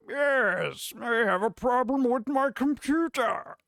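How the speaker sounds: Bane. Retold or imitated. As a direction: play Bane calling tech support, half-hearted. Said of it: "You could pretend you're Bane".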